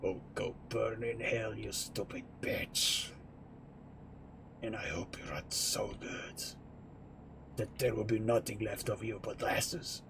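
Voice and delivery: menacing whisper. Retold or imitated. Voicing a scary thought about a hated ex, menacing whisper. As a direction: breathe a venomous wish under your breath. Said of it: "menacing thoughts ... something like this".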